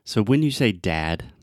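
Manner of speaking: ordinary talk, nothing marked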